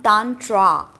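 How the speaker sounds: "tantra" said as tahn-traa, long open a's; mispronounced tantra. Shown as a demonstration. Teacher showing students the wrong way, mispronounced tantra. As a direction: stretch "tantra" into tan-TRAA, the common outsiders' way. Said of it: "it's not tantra".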